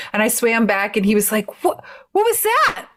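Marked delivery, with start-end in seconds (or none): 1.6-2.9 surprised. Retold or imitated. Boyfriend's puzzled reaction retold.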